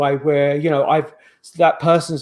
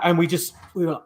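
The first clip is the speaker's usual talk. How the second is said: usual speaking voice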